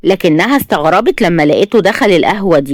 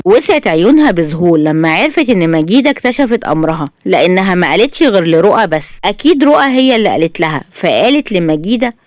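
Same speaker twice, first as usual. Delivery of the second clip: ordinary delivery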